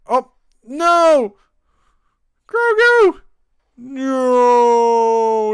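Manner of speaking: high, loud cries of dismay; shocked cry. Real, not acted. Watching Grogu get eaten, a loud shocked cry. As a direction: yelp in horror as he's grabbed. A character gets eaten on screen.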